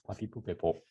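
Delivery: "Pa, pi, pu, pe, po" quiet and mechanical; robot. Shown as a demonstration. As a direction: say it like a little robot. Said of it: "like a robot".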